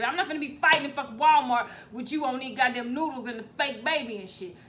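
ordinary talk

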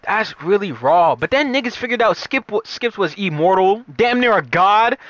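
ordinary talk, nothing marked

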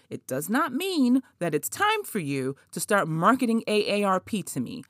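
ordinary talk, nothing marked

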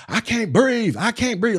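high, pleading imitation of panic; George freaking out. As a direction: mimic a panicked plea, pitched well up. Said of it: "that's when George started freaking out".

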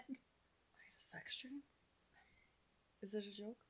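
breathless hushed whisper, slow. Pretend. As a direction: whisper it like a scandalised Madeline Kahn.

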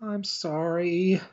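a timid maid caught out, sorry and panicking; flustered clumsy maid. Acted. Maid Felicia apologises to butler Jacob and then drops the snacks, in a high flustered voice.

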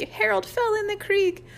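very high gleeful singsong; crowing. A narrator's reading. Mike crows that Harold fell in, high-pitched. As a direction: sing out the news, gloating. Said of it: "crowed Mike".

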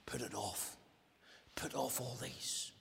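from soft low Put it off to a whisper; hushed command. Acted. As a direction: whisper the answer. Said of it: "His simple solution is".

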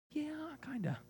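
hesitant, high whispered reply; reluctant admission. Acted. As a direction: whisper it as an awkward confession. Reluctant answer to forever question.

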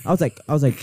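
ordinary talk

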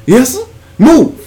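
high, loud "Move"; mock commanding bark. Shooing the guy away, a high loud mock order. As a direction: order him off like a cop.

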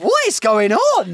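loud, high, drawn-out disbelief; incredulous outburst. Retold or imitated. Shopper reacting to staff bitching at the till, incredulous. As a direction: exclaim it slowly, pitched right up.

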